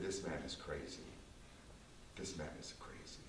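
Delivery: low, hushed disbelief, half under the breath; a quiet, shaken aside to himself; stunned hush. Retold or imitated. Shocked self quoted in disbelief.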